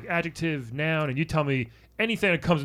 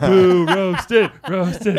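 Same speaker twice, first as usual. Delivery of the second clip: laughing boo; 7 semitones higher, 13 dB louder, much slower than usual. Acted. Mock booing at story.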